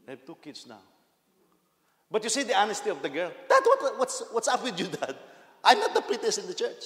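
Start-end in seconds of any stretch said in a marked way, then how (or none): 3.5-6.8 put-on much higher, loud, cheeky girl's voice; laughing daughter. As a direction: laugh it off at dad, high and loud.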